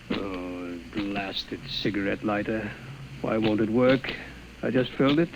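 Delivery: low, soft grumbling to himself; doctor alone, cursing his lighter; annoyed mutter. Acted.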